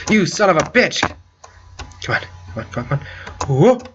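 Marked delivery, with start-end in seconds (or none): 2.0-3.0 softer breathless urging; willing it through under breath; tense whisper. Real, not acted. Tense moment in the game.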